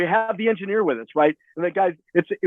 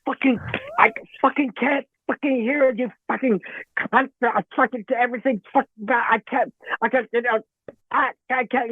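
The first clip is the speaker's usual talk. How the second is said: higher-pitched, choppy, sputtering complaint; 6 semitones higher than usual. Retold or imitated. Singer struggling in the studio headphones, flustered imitation. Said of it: "[name]'s out there, you know, with, with the headphones going".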